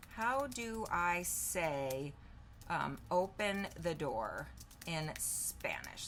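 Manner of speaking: slow, drawn-out words; spaced out at typing speed; dictating while typing. Real, not acted. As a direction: say it slowly as you type it. Typing a question while saying it.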